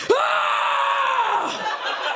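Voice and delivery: high, sustained mock scream; long yell. Man reacting to a tossed salad, mock yelling. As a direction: let out one long hollered "ah".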